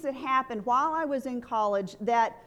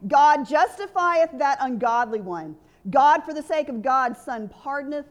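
preaching; 4 dB louder than usual. Acted.

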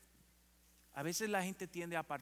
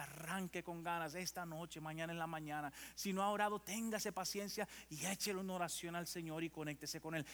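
usual speaking voice

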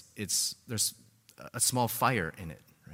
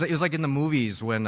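ordinary delivery

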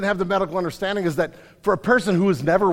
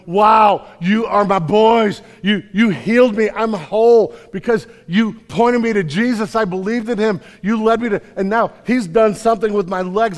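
loud, higher ecstatic cry; joyful shout; 5 semitones higher, 7 dB louder than usual. Retold or imitated. Healed man thanking Peter and John, joyful shout. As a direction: burst out with thanks.

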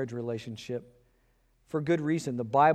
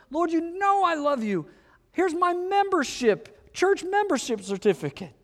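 eager believer. Retold or imitated. Believer protests more eagerly.

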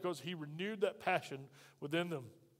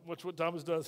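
normal talking voice

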